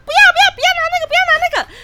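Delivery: put-on shrieked hysterical no at the boyfriend; hysterical shrieking. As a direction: scream no in hysteria. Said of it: "有点歇斯底里".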